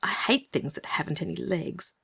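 put-on whispered, girlish; breathy whisper of dislike; whispered disgust. A narrator's reading. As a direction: hush it out with distaste. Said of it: "Anthea shudders at legless things".

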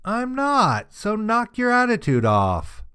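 high, scolding mock yell; mom yelling back. A narrator's reading. Mom yells back at her daughter, high scolding mock yell. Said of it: "My mom yelled back to me".

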